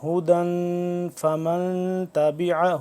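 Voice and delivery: slow chant, final n of هُدًى hidden in a long nasal hum; tajweed chant, softened nasal n in هُدًى; ikhfa. Shown as a demonstration. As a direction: recite هُدًى فَمَنْ with the n endings hummed through the nose. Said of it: "تو یہاں پہ اخفا کریں گے".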